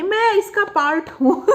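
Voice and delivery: drawn-out words tipping into a laugh. Real, not acted. Talking about bullies of looks, breaking into laughter.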